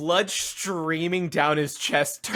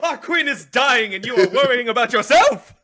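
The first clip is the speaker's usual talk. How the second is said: high, loud, rushed scolding; hammed-up indignant outburst; mock outrage; 9 semitones higher, 5 dB louder, faster than usual. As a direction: scold a comrade in disbelief, half laughing. In-character scolding of a worried character.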